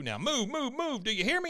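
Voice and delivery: hammed-up push to get moving; urgent mock order. Bill orders friends out of the tank, urgent and high.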